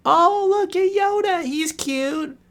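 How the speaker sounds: high, far-off mock wife voice cooing at Yoda; imitating wife. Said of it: "She laughs in my face".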